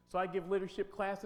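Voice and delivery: usual speaking voice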